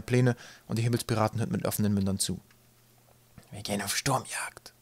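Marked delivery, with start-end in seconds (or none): 3.5-4.7 slow, soft reverent whisper; awed whisper. A narrator's reading. Crewman hearing the captain's plan, whispering in awe. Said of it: "flüsterte Tim Waterbuck ehrfürchtig".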